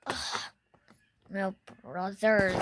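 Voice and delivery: normal talking voice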